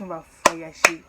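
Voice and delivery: loud quoted mother; mother's announcement. Retold or imitated. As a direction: call it out like mom. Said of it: "Quand elle m'a dit".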